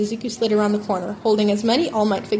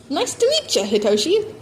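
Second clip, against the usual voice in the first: brash auntie; 6 semitones higher, 4 dB louder than usual. A narrator's reading. Mitsuki voice.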